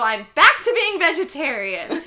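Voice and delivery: emphatic declaration. Retold or imitated. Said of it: "that made me go".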